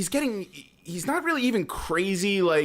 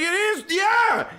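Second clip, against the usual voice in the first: high-pitched excited agreement; hammed-up eager yelp; mock vindication; 13 semitones higher, much slower than usual. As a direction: imitate a man thrilled to be understood. Retold man excitedly agreeing.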